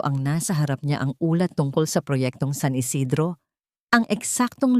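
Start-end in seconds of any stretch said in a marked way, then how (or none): none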